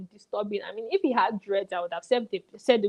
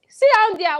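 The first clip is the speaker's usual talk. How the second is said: mock shout; 9 semitones higher, 8 dB louder than usual. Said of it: "I said".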